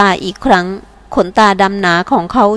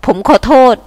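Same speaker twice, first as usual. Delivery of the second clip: plain reading voice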